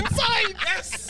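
high mocking echo of inside; mock guard. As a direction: squeak it out like the guard. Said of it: "He's like".

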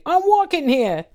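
high-pitched New York impression; mock New York bellow. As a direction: quote it as a cartoon New Yorker. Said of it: "impression of someone from New York".

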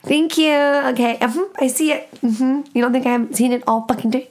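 high, mock-sweet sarcasm, then a fast rant; sarcastic retort. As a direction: pitch it way up, fake polite, then rattle off the complaint. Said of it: "I'm like".

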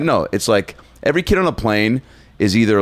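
ordinary delivery

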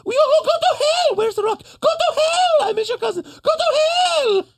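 very high shouted falsetto breaking into laughs; laughing mock screaming. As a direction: shriek through the laughter. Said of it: "[name] just keeps yelling".

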